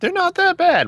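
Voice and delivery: high mocking quote of a friend. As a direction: mimic her breezy dismissal. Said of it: "Jen's all like".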